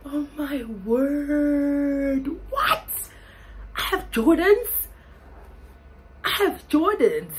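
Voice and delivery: loud, high disbelieving exclaim; stunned delight. Real, not acted. Finding Jordans from her brother, shocked joy. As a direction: shriek at the surprise.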